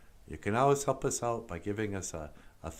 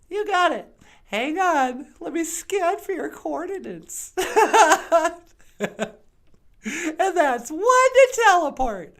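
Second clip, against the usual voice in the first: very high, loud cartoon puppet greeting; high puppet bonjour; 7 dB louder, slower than usual. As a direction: squeak it out like a bubbly puppet. Puppet character voice.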